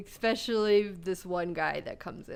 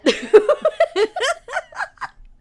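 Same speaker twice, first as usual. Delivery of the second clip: very high loud burst of laughter; shrieking laugh; 10 dB louder than usual. Real, not acted. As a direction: let the laugh explode. Laughing at the punchline.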